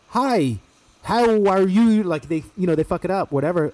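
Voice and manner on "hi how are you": put-on slow, high, loud Spanish-accented English. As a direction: slowly imitate a Spanish accent. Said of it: "if a Spanish person were to say to me".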